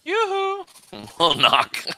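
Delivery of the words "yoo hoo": high sing-song yoo-hoo; sing-song call. Pretend. Calling at the door.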